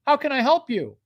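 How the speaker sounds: high, loud salesman phone greeting; over-eager manager greeting. Acted. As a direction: answer the phone with hammed-up eagerness. Manager's greeting to caller, repeated.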